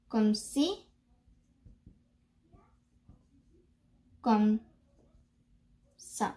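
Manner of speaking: "comme ci ... comme ... ça" in separate pieces; long pauses inside "comme ci, comme ça", lifting on "ci"; broken-down pronunciation model. Shown as a demonstration. Teacher showing how you pronounce it, piece by piece. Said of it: "the way you pronounce it".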